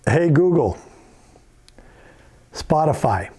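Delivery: loud clear command to the assistant. Shown as a demonstration. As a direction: spell it out for the mic. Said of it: "So if I go".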